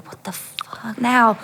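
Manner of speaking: slow, voiceless whisper; retold exasperated whisper; acted whispered disbelief. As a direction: whisper it slowly, as a private thought. Horrified thought as man approaches.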